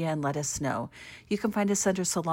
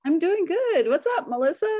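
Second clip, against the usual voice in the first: excited; 11 semitones higher, 6 dB louder than usual. Real, not acted. Excited reply to greeting.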